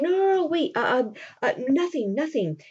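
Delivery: high, stammering mock cover-up; flustered denial. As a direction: fumble to hide something, voice settling down. Cyrano hastily covers up, acted.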